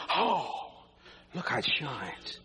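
low, slow breathy admiration; mock admiring. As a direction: swoon over the ring.

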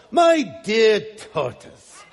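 slow, put-on British scorpion; drawn-out, hammed-up British accent. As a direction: address the tortoise in a mock British accent. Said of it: "he would have a British accent".

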